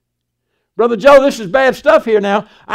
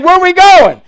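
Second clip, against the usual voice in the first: demanding question; 6 semitones higher, 7 dB louder, slower than usual. Retold or imitated. Sarah questions Abraham's sudden move.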